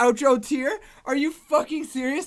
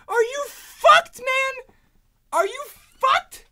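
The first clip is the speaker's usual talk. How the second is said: high, slow, drawn-out disbelief; incredulous wail; 11 semitones higher, much slower than usual. Real, not acted. Fan floored by the song, incredulous wailing. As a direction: wail it slowly, unable to believe it.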